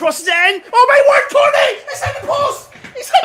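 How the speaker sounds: screaming at a near miss; high, loud excited yelling; man down shout. Real, not acted. Shot hits the post live.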